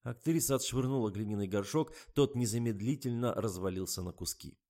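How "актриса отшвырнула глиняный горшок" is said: plain reading voice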